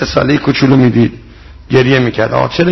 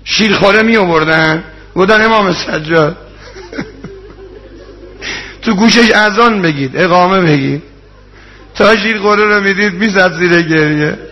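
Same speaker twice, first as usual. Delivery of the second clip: raised, tearful rowze recital to the congregation; mourning sermon; 5 semitones higher than usual. Acted. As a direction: preach the tragedy at full force, voice straining. Rowze mourning recital at full force.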